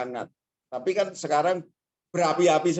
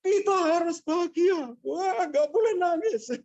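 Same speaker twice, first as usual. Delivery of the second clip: much higher, insistent scolding; scolding on the phone. Retold or imitated. Student phoning her sibling, a firm scolding. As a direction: tell the sibling off firmly. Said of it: "Marah-marah adiknya".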